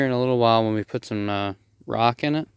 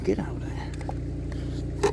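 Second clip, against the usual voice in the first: whisper; 9 dB quieter, much slower than usual. Real, not acted. Shooing someone out of the hole.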